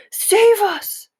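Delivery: high, loud terrified giant's scream; a dreaming giant begs for rescue. A narrator's reading. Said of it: "screamed the flesh lump eater".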